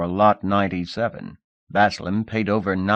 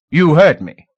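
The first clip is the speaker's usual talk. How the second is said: louder, higher, cold order; stern command; 8 semitones higher, 11 dB louder than usual. Acted. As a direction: shut him down. Lord commands.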